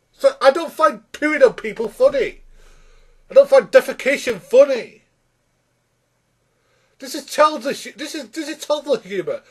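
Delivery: high-pitched exasperated rant; protest, pitch well up and insistent. Real, not acted. Reviewer ranting at childish toilet jokes, exasperated.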